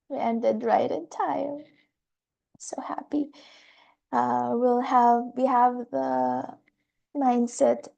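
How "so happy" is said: whisper. Real, not acted. Quiet aside after the session.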